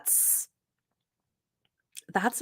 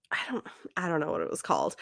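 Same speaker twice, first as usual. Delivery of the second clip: fast whispered aside, under the breath; hurried whisper as if to herself; 3 semitones lower, 7 dB quieter, much faster than usual. Real, not acted. Podcast host forgetting a story title, whispered aside.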